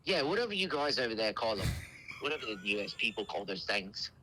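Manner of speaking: dismissive prank caller in fake British; mock British grumble about American terms; British accent. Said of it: "the best British accent I've ever heard".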